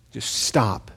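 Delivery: hushed stop. Acted. God shushes Habakkuk.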